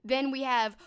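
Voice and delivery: ordinary talk, nothing marked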